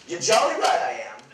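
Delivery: loud, high mock-British exclamation; put-on British accent. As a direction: proudly fake a British accent. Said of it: "But where's your accent?".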